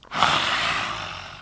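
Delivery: long breathy lion's breath out; lion's breath exhale. Shown as a demonstration. Yoga teacher showing a child lion's breath, breathy exhale. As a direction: push the breath out like a lion. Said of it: "so let's do lion's breath".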